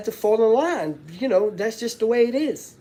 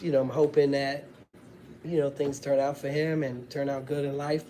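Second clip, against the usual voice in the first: usual speaking voice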